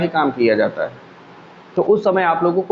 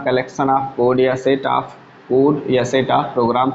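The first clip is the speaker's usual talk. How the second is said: slow, each word spaced for writing; teacher dictating slowly for students to write down; slow dictation; 3 semitones lower, much slower than usual. Shown as a demonstration. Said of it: "इस चीज़ को आप लोग लिख लीजिएगा".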